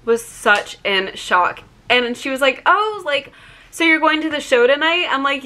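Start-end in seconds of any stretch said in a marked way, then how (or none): 2.6-5.1 high-pitched imitated caller; lightly mimicked caller, pitched up; phone caller. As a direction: lift the pitch for the caller's question. Retold caller's friendly question.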